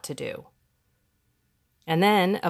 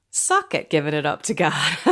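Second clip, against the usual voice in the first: laughing; 4 dB louder than usual. Real, not acted. Joking with a dear friend.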